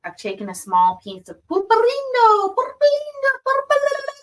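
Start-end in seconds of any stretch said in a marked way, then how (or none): 1.5-4.1 singsong. Pretend. Playful repeating of candy name.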